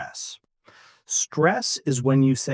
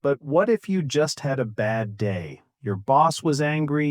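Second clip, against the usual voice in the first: normal talking voice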